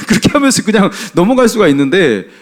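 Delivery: ordinary talk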